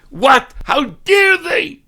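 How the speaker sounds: high loud outraged yell; mock furious shout, slowed on dare. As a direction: explode at the lawyer's email. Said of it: "And you start screaming".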